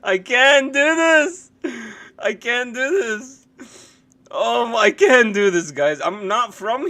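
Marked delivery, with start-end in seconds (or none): 0.0-5.7 high, slow, helpless laughing words; can barely get it out through laughter; crying with laughter. Real, not acted. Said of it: "I actually was crying".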